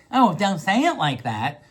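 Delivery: hurt, put-on complaint with a lilting end; wounded tone. Shown as a demonstration. Podcaster showing how tone changes the same words, wounded complaint. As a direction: stretch it out as a touchy, wounded protest. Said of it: "different tones".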